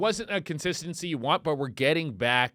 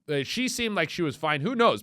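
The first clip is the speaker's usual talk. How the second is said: normal talking voice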